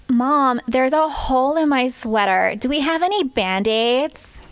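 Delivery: exaggerated American, slowing into the question; American accent. Shown as a demonstration. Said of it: "in an American accent".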